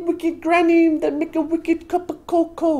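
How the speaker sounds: sing-song granny boasting about cocoa; mock British granny voice. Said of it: "I'm a wicked".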